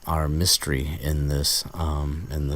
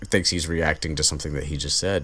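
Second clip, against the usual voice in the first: normal talking voice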